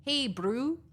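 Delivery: Hey, brew with a Kiwi bro vowel; New Zealand greeting; Kiwi accent. Retold or imitated. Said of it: "a Kiwi will say".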